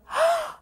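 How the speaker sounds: put-on high excited gasp of a small child; delighted gasp. Little nephew running out, high delighted gasp. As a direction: gasp with a toddler's joy. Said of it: "this little blonde bullet would come out... and go".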